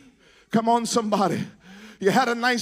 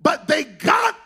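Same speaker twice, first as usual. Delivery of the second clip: high, loud, chanted preaching over the organ; full-force preaching; 6 semitones higher, 3 dB louder than usual. Real, not acted. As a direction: preach it to the rafters with rising fervor. Preacher builds to full-force climax.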